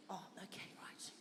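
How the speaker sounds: soft whispered aside. Real, not acted. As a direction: whisper it to yourself.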